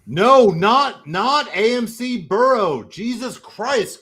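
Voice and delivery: high, loud, swooping objection; real exasperated outburst; exasperated protest. Real, not acted. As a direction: protest loudly with each word punched. Loud protest at theater choice.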